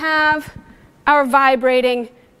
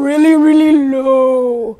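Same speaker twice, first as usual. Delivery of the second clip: "really, really low" dropped low and drawn out slowly; 3 semitones lower, 4 dB louder, slower than usual. Shown as a demonstration. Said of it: "changing the pitch of sound".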